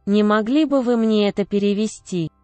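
ordinary talk

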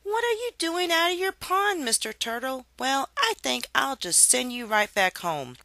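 put-on high, sugary baby talk; high sweet talk to a turtle; sympathetic Harriet. A narrator's reading. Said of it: "with a sympathetic smile".

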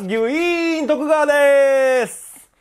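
high, long-held shouted name; very high, stretched-out holler; loud self-introduction. Shown as a demonstration. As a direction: belt out your stage name at full volume. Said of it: "だんだん大きくして".

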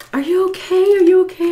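put-on high-pitched worried girl voice; concerned passer-by. Girl walking by asks crying woman, high concern. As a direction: imitate a stranger checking in, very high.

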